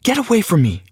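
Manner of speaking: high sharp snap; snapping. A narrator's reading. Girlfriend pushing the narrator away, a high sharp snap. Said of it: "she snapped at me".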